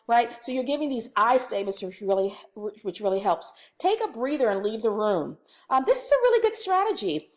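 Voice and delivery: ordinary delivery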